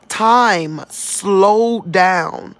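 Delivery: very slow, stretched words. Real, not acted. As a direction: stretch it out as if time itself slows. Telling how time slowed down.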